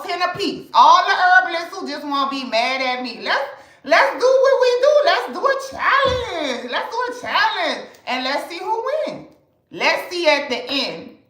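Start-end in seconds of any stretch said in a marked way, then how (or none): 3.2-9.3 excited dare. Real, not acted. Challenging hating herbalists, hyped up. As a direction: throw down the gauntlet.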